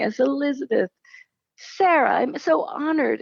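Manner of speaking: high, thrilled and honored; bright-eyed honored gush; excited. Retold or imitated. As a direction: lift it up, delighted. Continuing retold prayer.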